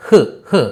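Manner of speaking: హ్ as a short bare h; clipped final consonant. Shown as a demonstration. Teacher explains the ending, హ్ cut to a bare h. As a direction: puff out హ్ with no full vowel. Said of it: "దాన్ని మనం పొల్లి పలకాలి".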